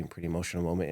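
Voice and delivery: ordinary talk, nothing marked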